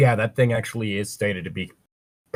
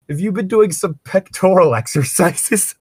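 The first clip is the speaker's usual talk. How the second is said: laughing question; 9 semitones higher, 3 dB louder than usual. Acted.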